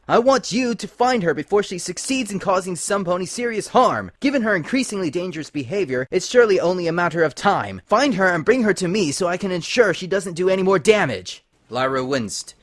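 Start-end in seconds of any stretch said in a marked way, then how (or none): 0.1-11.4 high, loud and fast order; angry command. Acted. Celestia angrily orders the spies to find Twilight. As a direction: drive the threat home. Furious princess giving orders.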